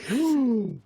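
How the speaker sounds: exaggerated high gasp; appalled intake of breath, put on; mock shocked gasp. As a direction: imitate scandalized onlookers gasping. Imitating shocked viewers.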